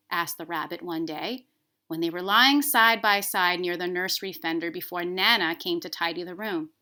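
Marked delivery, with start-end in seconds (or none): none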